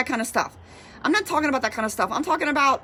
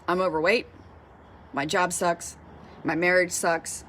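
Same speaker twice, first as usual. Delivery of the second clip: low, subdued, acted defeat; glum self-talk; 7 semitones lower, 4 dB quieter than usual. Waking up to negative self-talk, glum. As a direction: list the complaints low, as if resigned.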